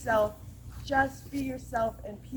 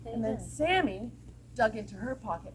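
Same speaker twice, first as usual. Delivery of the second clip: plain reading voice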